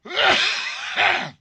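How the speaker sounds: harsh loud hacking cough; coughing fit, chest rattling. Real, not acted. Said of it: "I'm coughing".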